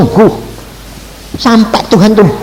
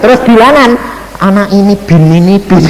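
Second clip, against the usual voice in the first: ordinary talk, nothing marked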